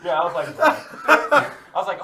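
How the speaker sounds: laughing. Real, not acted. Laughing at himself.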